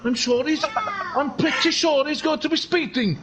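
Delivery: high, excited Welsh commentary; Welsh accent. Pretend. Commentator following Phil's overtake, excited Welsh accent. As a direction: call the overtake in lilting Welsh. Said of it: "this is an accent I've been doing".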